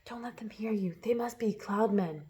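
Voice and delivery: hushed, huddled murmur. A narrator's reading. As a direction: murmur it under your breath. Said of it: "they murmured, huddling closer together".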